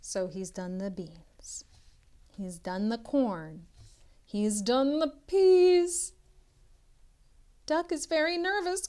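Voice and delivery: usual speaking voice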